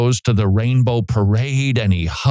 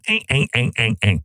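string of short mock pestering "eh" noises; imitated pestering; faster than usual. Preacher mimics religious leaders coming at Jesus, pestering noises. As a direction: jab out little nagging noises in imitation. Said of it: "these religious leaders coming at him".

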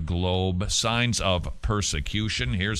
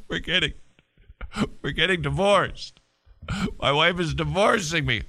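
higher-pitched, hammed-up sobbing; mock crying; 6 semitones higher than usual. Man telling co-workers about his divorce, mock crying. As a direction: blubber it out between comic sobs. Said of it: "and he's crying".

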